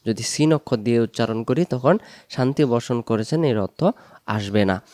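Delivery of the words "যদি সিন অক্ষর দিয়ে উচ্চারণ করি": ordinary talk, nothing marked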